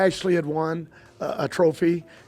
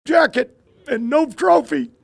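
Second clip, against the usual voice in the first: put-on upset kid; 9 semitones higher, 7 dB louder than usual. Grandson protesting about the jacket, high and upset. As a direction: drag it out like a crushed kid. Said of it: "And he said".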